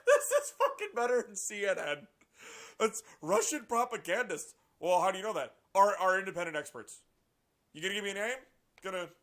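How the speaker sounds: loud, high incredulous ranting; amused outrage; laughing rant. Real, not acted. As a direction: heckle the article, loud and high.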